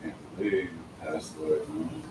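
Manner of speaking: ordinary talk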